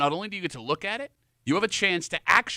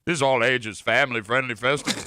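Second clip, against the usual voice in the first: lower, olde-worlde herald voice; mock medieval proclamation, slightly Southern; 4 semitones lower than usual. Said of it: "And I gotta do the medieval".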